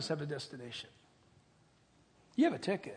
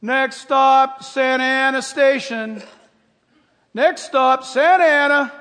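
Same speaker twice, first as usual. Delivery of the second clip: loud, higher conductor call; train conductor; 5 semitones higher, 15 dB louder, slower than usual. Retold or imitated. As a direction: call the stops down the aisle. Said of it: "walking through the car like this".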